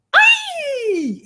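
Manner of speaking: a very high, squeaky "ay" to call the puppy; silly high call. Retold or imitated. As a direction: play the fool, squeal to lure the pup. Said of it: "me ponía a hacer el gilipollas en la montaña y decía". (grito agudo y tonto para llamar al cachorro)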